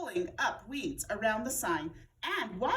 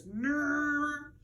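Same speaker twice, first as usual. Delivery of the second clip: droning airplane nrrr; airplane noise; 4 semitones higher than usual. A narrator's reading. Camilla plays airplane on the playground, droning. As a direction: hum like a toy plane. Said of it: "made airplane noises".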